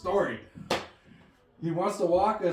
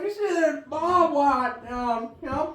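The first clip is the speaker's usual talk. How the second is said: Mudbone impression; 5 semitones higher, 3 dB louder than usual. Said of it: "Granddad sounds like Mudbone".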